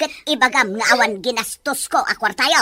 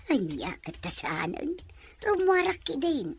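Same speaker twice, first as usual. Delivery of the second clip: put-on a sneaky old woman whispering to herself with a chuckle; hushed, scheming whisper; sly laughing whisper; 12 dB quieter than usual. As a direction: giggle under the breath and whisper softly. Grandma sneaking out alone.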